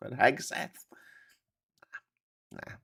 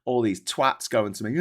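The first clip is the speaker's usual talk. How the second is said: ordinary talk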